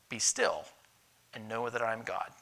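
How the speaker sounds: low and soft, a hushed divine reassurance; hushed sacred voice. Retold or imitated. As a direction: speak it as God calming the heart. Repeats God's word.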